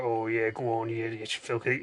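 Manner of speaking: low, soft mock mutter; muttering under breath. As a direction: murmur it to yourself, half joking. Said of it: "muttering just under your breath".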